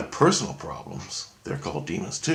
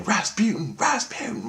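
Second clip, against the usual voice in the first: high calling voice; 13 semitones higher than usual. Retold or imitated. Inner voice calling the name.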